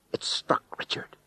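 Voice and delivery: hushed whisper. A narrator's reading. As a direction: breathe it out. Climax told in whisper.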